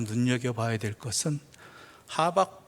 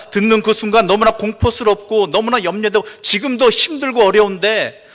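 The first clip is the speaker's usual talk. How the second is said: loud, raised pulpit delivery; impassioned preaching; 7 semitones higher, 14 dB louder than usual. Real, not acted. Preacher admitting to congregation the terror ahead, impassioned preaching. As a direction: pour out the dread with force.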